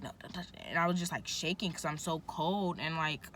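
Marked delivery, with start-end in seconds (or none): 0.0-0.6 whispered, much softer; mock nurse whispering no; whispered nurse reply. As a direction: breathe the refusal.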